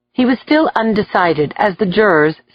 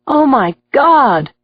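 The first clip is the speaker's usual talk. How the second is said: moan; 6 semitones higher, slower than usual. A narrator's reading. Gallery onlooker moaning at the confession. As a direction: groan in shock. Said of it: "Someone in the gallery moaned".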